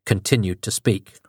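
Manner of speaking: ordinary delivery, nothing marked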